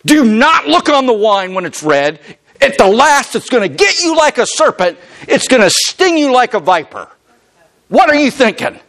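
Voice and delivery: a father begging his son to stop; pleading father. Retold or imitated. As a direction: Solomon warning his sons, high and urgent. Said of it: "And he's saying".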